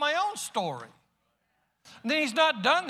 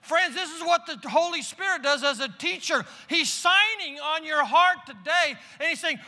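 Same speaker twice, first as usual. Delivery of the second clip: raised, driving sermon delivery, higher pitch; full-force preaching; 6 semitones higher than usual. Acted. Preacher urging the congregation to hear the Spirit, full-force preaching. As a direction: preach it out with rising fervor.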